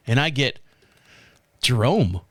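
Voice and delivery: usual speaking voice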